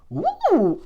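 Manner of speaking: high-pitched whoop; poker-hand whoop, way up high; excited woo. Acted. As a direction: let out a high, giveaway "woo". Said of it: "'Cause I'd be like".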